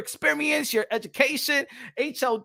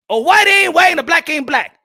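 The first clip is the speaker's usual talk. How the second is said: louder, higher repeat; punchy chant of the line; shouted slogan; 5 semitones higher, 9 dB louder than usual. Real, not acted. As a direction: shout the slogan back at them. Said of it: "slogan said twice, shouted repeat".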